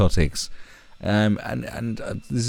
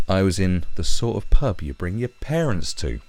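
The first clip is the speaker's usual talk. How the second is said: ordinary delivery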